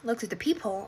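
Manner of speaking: usual speaking voice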